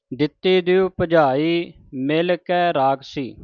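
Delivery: measured, chanted reading of sacred verse; scripture recitation. Shown as a demonstration. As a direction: recite each line deliberately, with pauses.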